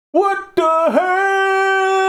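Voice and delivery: very high, slow, drawn-out singsong; mock-horrified wail. Streamer reacting to ugly sneakers, wailing in mock horror. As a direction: stretch the shock into a high wail.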